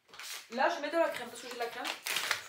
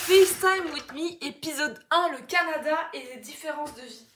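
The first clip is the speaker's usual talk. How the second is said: loud, high, stretched-out title call; big playful intro voice; mock show announcer; 4 semitones higher, 11 dB louder than usual. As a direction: announce the episode like a show host. Announces pretend episode title.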